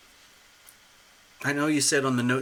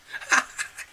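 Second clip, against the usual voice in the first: breathy, voiceless burst of laughter; giddy laugh. Real, not acted. Host freaking out over the booking, giddy laughing. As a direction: laugh yourself out of words.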